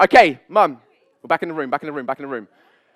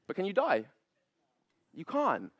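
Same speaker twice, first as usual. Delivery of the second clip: usual speaking voice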